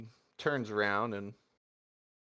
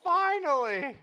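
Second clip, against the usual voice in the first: overjoyed gushing; 6 dB louder, much slower than usual. Acted. Hugging Zenith, gushing with pride.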